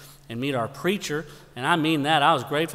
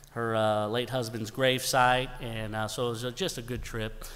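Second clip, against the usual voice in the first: ordinary talk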